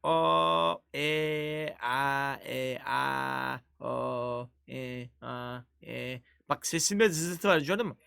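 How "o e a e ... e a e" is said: strained vowels sinking lower and softer; hoarse throat demo. Shown as a demonstration. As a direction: show off a croaky sore throat. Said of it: "bak sesim düzür diyor".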